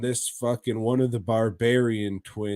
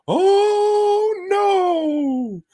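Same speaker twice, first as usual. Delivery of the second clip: falsetto shriek of horror; campy high scream, oh no; mock horrified shriek; 6 dB louder than usual. Horror at the transformation.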